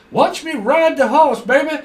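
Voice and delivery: high hammed-up rider voice; mock showy rider. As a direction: ham it up like a rider bragging.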